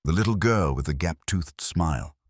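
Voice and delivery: ordinary delivery, nothing marked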